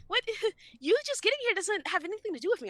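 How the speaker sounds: fed-up quick indignant comeback; indignant retort. Retold or imitated. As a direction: rattle it off in disbelief. Retold reply to late professor.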